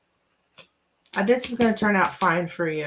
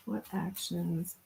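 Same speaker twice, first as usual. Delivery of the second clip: softer and slower, trying the words again; soft slow murmur; 11 dB quieter, slower than usual. Shown as a demonstration. Reader softly restarting the question. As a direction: murmur it slowly to yourself. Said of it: "What is wrong with me?".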